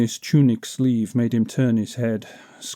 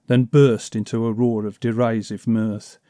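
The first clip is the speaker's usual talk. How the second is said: ordinary delivery, nothing marked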